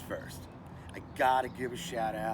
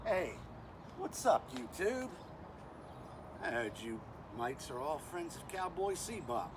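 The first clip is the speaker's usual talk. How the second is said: fake Australian accent; 4 dB quieter than usual. Bushman introducing himself to YouTube, fake Australian accent. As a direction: put on a bad Australian accent. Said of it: "with my fake Australian accent".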